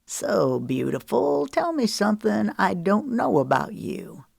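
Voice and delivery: a hint of a sleazy come-on; smooth and creepy; lightly voiced smarmy flirt. Man pressing a woman with flirting, smooth and sleazy.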